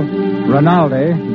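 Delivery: ordinary delivery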